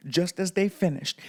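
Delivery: plain reading voice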